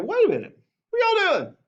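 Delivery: high, put-on voice of a young officer who can't believe it; George's protest. Young George protesting to the French, high outraged voice. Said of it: "he's like".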